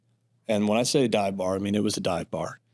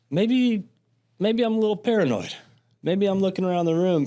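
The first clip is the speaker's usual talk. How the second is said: mock paranoid; 9 semitones higher than usual. Describing getting paranoid while high.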